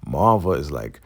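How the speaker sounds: ordinary delivery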